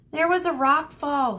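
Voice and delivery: high, loud panicked scream. Acted. Cassandra screaming back to Medusa, loud panicked voice. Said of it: "screamed Cassandra".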